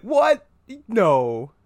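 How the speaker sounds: loud, high yelp of disbelief; mock disbelief. Reacting to a character named Jessica, loud and shocked. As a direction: blurt it out in shock.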